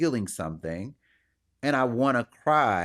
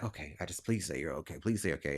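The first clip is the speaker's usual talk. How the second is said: much softer, lower begging please say okay; pleading mock hush; 6 semitones lower, 10 dB quieter, faster than usual. People pleading with him, hushed mock begging. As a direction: quietly beg him to say he's fine.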